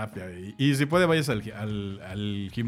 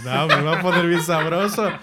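louder, higher mock delight; playful excitement; 5 semitones higher, 8 dB louder than usual. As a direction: tease it with a laugh in the voice. (emoción juguetona con risa)

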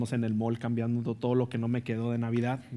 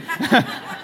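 real laugh; 11 semitones higher, 9 dB louder than usual. Real, not acted. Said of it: "laugh alone".

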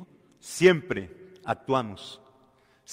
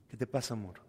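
tender concern; 2 semitones lower, 8 dB quieter, faster than usual. Acted. Husband gently asks crying wife.

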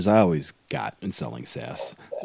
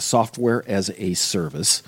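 normal talking voice

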